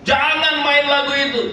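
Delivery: very high-pitched mock yell; retold shout. Angry foreigner stands up to stop the pianist, acted shouting. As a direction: yell it like an outraged customer. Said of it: "dia teriak".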